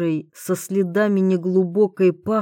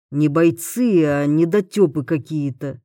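ordinary delivery

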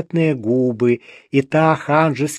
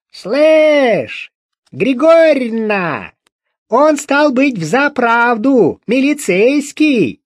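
much higher, louder crone calling across the flat, slowing at the end; nasty creaky yell; 9 semitones higher, 5 dB louder, slower than usual. Acted. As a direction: bawl it out to the other room, high and grating. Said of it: "заорала противным скрипучим голосом". (заорать противным скрипучим голосом)

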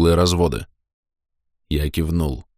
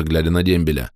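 ordinary delivery

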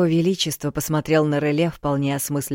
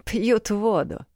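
slow, higher dismissive snort; 6 semitones higher, slower than usual. A narrator's reading. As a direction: scoff at it slowly. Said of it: "фыркнул дух замка".

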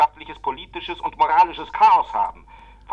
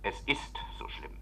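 hushed, grave whisper; grim whisper; 4 semitones lower, 12 dB quieter than usual. Acted. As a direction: breathe it out, barely voiced. Grave admission of crisis.

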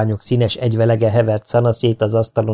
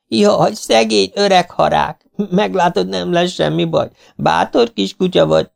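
heartrending cries; 9 semitones higher than usual. A narrator's reading. Owners cry out as the vet lifts their dog, anguished. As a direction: wail and comfort the hurt dog at once. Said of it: "szívszaggató kiáltozásba kezdtek".

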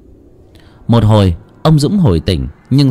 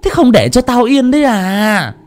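put-on high, grumbling old aunt; grumpy old woman; 10 semitones higher, slower than usual. A narrator's reading. Old shaman annoyed at being called out, grumbling. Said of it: "lục cục đi ra".

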